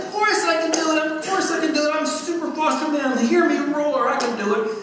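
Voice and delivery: high-pitched, over-confident boast; boastful bravado. Retold or imitated. Acting out his boastful reply to wife.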